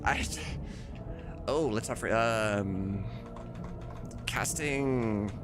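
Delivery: normal talking voice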